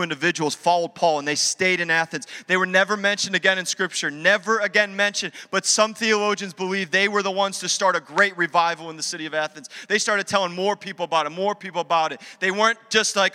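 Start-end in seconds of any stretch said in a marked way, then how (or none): none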